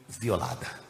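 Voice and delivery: ordinary talk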